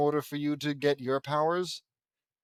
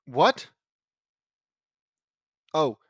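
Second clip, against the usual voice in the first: ordinary delivery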